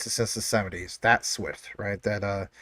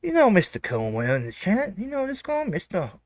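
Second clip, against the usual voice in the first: mock Australian accent; 11 semitones higher than usual. Streamer greeting chat members in a put-on Australian accent. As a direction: greet the chat like a hammed-up Aussie. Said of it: "Bro, you don't even sound like us".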